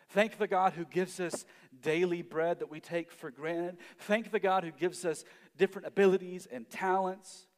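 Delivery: rising pulpit fervor; preaching at full force. Acted. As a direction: proclaim each thanks to the room.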